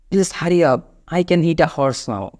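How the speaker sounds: slow and clear; each word laid out for learners; slow model sentence. Shown as a demonstration. Teacher showing hungry diner's line, slowly spelled out. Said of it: "এটা বলতে পারেন".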